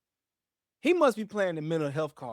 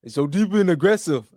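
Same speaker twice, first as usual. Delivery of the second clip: put-on loud, rough try at an African accent; imitation African accent, forceful; attempted African accent; 7 dB louder than usual. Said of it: "that sound more African".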